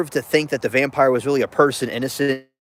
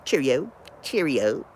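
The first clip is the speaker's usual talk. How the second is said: mock British Cheerio; 4 dB quieter, slower than usual. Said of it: "I had to do that".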